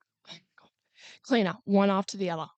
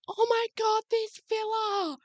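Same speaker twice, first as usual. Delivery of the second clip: gawking tourist; 10 semitones higher, 5 dB quieter than usual. Retold or imitated. Strangers gawking in their villa.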